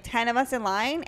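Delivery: ordinary delivery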